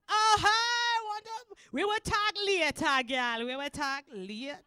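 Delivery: loud, high Jamaican greeting; Jamaican accent. Acted. Comic greeting a fellow Jamaican in the crowd, Jamaican accent. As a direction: call out chummily in a Jamaican accent. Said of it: "Any Jamaicans here?".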